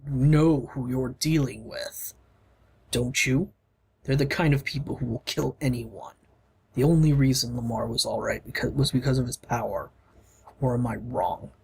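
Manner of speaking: deep hero voice. Acted. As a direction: speak as a big, steady warrior. Gar explains the danger.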